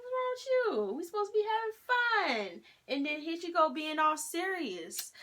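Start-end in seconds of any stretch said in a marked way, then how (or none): 0.0-2.6 much higher, scolding the dog in disbelief; puzzled call to the dog. Retold or imitated. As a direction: call out to your dog like she's lost her mind. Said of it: "I was like".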